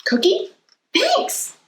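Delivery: a hint of a high child voice; lightly voiced kid. A narrator's reading. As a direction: offer a cookie like a friendly kid. Kids offering cookie.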